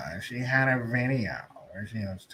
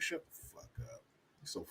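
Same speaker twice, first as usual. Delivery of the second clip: whispered shut-up; whispered scorn; 5 semitones higher, 19 dB quieter, faster than usual. Real, not acted. Whispering scorn at Kamala's claim. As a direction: breathe it out in a whisper, fed up.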